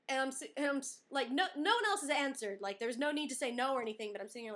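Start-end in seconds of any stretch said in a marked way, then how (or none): none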